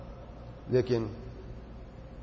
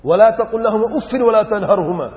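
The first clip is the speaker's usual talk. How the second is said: Quranic recitation; 6 semitones higher, 7 dB louder than usual. Acted. Quranic verse recited in sermon.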